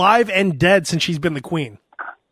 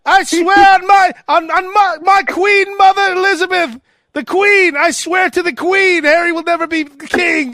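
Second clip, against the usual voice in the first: pompous royal; 10 semitones higher, slower than usual. Pretend. Charles swearing to the queen.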